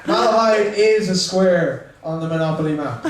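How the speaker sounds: normal talking voice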